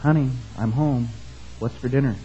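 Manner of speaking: hushed, softened voice; stage-murmur to the wife, close and soft; mock whisper. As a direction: say it as if into her ear. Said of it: "goes up to her and whispers".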